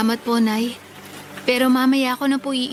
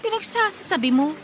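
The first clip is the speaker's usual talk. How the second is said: higher, dismissive question; what nonsense are you saying; scoffing disbelief; 6 semitones higher than usual. Acted. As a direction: sister brushing off her brother. Sister scoffs at brother's request.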